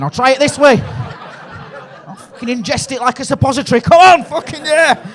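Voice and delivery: high, loud, slow hammed-up drunk cheer; drunk lad whoop. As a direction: crow it like a wasted lad showing off. Mock drunk lad boasting.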